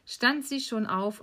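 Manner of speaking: plain reading voice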